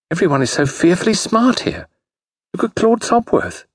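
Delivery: put-on very high, snobbish society girl; high-pitched ladylike admiration; gushing young lady. A narrator's reading. Grace gushing about smart people.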